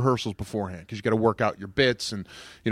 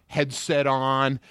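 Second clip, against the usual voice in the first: normal talking voice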